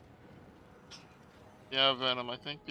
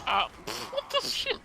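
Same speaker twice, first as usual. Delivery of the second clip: laughing high cry of disbelief; stunned by a sudden death; shocked; 6 dB louder than usual. Real, not acted. Killed again in game.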